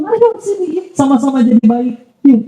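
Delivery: a pious friend gently inviting a newcomer to join; gentle invitation. Retold or imitated. Pious man welcoming a sinner.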